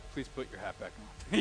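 low, soft, deadpan backdown; subdued plea. Retold or imitated. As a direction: say it small and low, defeated. Retold pastor gives up, punchline.

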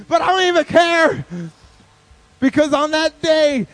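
high, loud triumphant shout; rousing victory cry; exultant declaration. Acted. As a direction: proclaim it at full volume. Storyteller exults in the win.

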